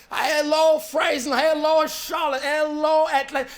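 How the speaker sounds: loud, high, beaming hellos to each city; excited greeting. Retold or imitated. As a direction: call out like a newcomer thrilled to arrive. Coming to America arrival greeting.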